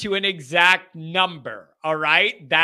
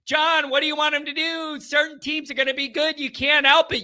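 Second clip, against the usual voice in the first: mock critic voice; 7 semitones higher than usual. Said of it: "imagined critic addressing John".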